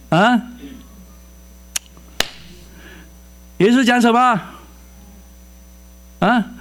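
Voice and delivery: preacher calling out; preaching call. Real, not acted. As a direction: rouse the congregation.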